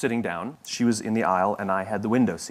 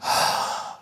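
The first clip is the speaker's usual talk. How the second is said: imitated annoyed sigh; a put-upon exhale, lightly mocked; begrudging sigh. Seatmate making room for the speaker, mock begrudging sigh. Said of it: "begrudgingly moved her legs ... and went".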